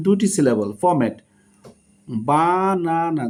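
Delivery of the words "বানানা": "বানানা" stretched as বা-না-না; teacher sounding out "বানানা" in three beats; split into syllables. Shown as a demonstration. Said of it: "that means তিনটি syllable".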